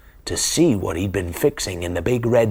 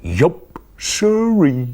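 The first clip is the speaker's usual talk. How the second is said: loud cow drawl on siree; Cow's voice; 5 semitones higher, 4 dB louder, much slower than usual. Acted. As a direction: play the stubborn farm cow. Cow's catchphrase voice.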